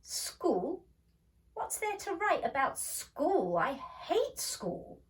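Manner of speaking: put-on high, scornful girl's outburst, slowed on hate. A narrator's reading. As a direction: sneer at the very idea of school. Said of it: "Margie was scornful".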